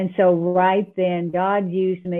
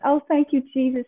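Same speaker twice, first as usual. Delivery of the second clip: higher-pitched imagined thanks; mock grateful prayer; 7 semitones higher than usual. What she should have said to God, mock gratitude. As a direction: pretend to gush thanks to Jesus. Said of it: "I should have been going".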